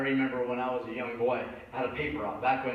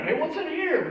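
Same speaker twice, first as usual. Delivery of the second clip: put-on a kid peering into a dumpster, much higher; playful child's voice, higher and louder; curious kid; 12 semitones higher, 4 dB louder than usual. Kids dumpster diving, curious.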